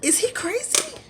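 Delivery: acted-out incredulous question, pitched very high; high, puzzled recoil; baffled disbelief. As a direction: squeak it out in mock bewilderment. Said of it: "That's how I was looking at him".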